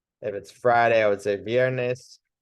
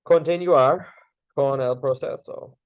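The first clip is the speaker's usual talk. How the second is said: calm instruction; 3 semitones higher than usual. Shown as a demonstration. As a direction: tell them you'll continue. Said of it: "I would go".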